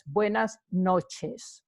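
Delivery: buenas noches drawn out slowly; slow Spanish demo. Shown as a demonstration. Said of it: "teaching demo".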